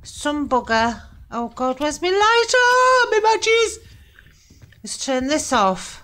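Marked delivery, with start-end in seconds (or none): 1.8-3.8 frantic. Real, not acted. Fumbling in the dark.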